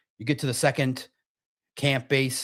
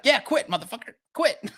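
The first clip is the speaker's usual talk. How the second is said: higher, dismissive quitting guide; mock Sherpa; 7 semitones higher than usual. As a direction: brush him off, go home. Sherpa telling him to quit.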